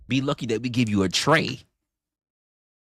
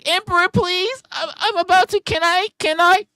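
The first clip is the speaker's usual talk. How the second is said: high squeaky pleading, much louder; mock begging falsetto; 10 dB louder than usual. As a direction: beg in a hammed-up falsetto. Mocking a begging woman.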